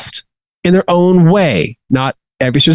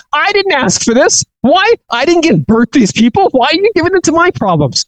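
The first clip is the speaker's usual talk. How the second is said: much higher, whining complaint to God; exasperated Moses; 11 semitones higher than usual. Retold or imitated. Moses complaining to God in a high, exasperated whine. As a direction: voice Moses protesting to God that he never asked for this. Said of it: "he tells God".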